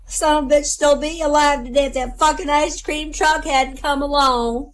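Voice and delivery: high, mock-tearful granny outburst; tearful grandmother. Widowed grandmother blaming the ice cream truck, mock tearful. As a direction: lament it as a high-voiced old lady. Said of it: "she wiped a tear away".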